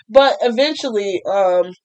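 normal talking voice

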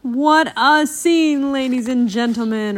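dramatic news reporter voice; reporter on air. A narrator's reading. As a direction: present it to a broadcast audience.

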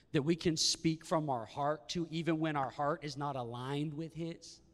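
ordinary delivery

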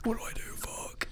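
whispered panic; panicked whisper. Retold or imitated. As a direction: hiss it out like a frightened kid. Panicked Batman quote whispered.

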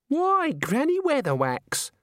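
high-pitched, drawn-out delighted greeting; happy. A narrator's reading. Young woman greeting Granny at the door, happily. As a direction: greet a welcome visitor in a high girlish voice. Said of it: "said the girl happily".

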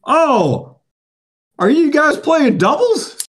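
high, slow, naive mocking voice; imitating the oblivious opponent; mock clueless guy. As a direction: play dumb, high and drawn out. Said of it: "he's like".

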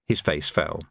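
plain reading voice

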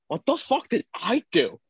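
high, incredulous "What the fuck did I do?"; a voice jumping up in shock at the accusation; high-pitched mock outrage; indignant disbelief. Accused by bouncer, protests.

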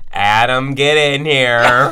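loud, drawn-out call; calling someone over. Retold or imitated. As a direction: summon Adam loudly, stretching the words. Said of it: "She's like".

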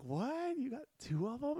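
high, incredulous double-take question; mock Dylan gasping in disbelief; imitating Dylan's shocked "two of 'em?"; surprised disbelief. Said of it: "Dylan's like".

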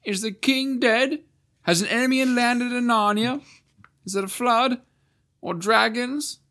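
high, comic character voice; high, anxious questions; high comic Marsh-wiggle voice; Puddleglum's voice. A narrator's reading. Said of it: "came a voice".

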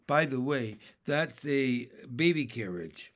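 ordinary delivery, nothing marked

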